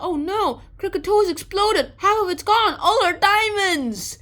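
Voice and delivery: high, loud mock panic; panicked crowd. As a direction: wail over the lost diamonds. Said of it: "they're like".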